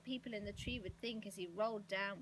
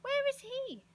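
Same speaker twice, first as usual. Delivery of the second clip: loud, very high eager question; excited cry; 10 dB louder than usual. A narrator's reading. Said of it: "he cried".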